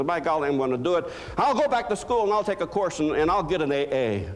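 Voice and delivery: gather your courage and declare it; the wife decides to go back to school, fired up; determined wife. Retold or imitated. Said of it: "And she says".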